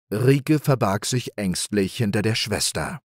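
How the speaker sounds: ordinary delivery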